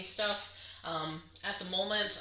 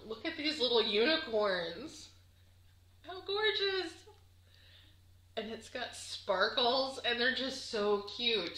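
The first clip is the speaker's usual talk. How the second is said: higher, louder delighted cooing over fabric; excited gushing; 6 semitones higher, 4 dB louder, slower than usual. Real, not acted. Showing viewers the unicorn fabric, higher delighted gushing.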